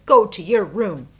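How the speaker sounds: loud, sharp scolding; angry mother. A narrator's reading. Mother angrily sending Jack to his room. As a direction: send him off furiously. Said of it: "She was very angry with Jack".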